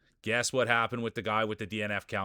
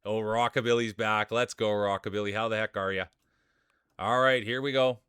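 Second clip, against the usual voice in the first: race announcer. Acted. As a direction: call the racers onto the track like a ringside announcer. Calls the marble race start.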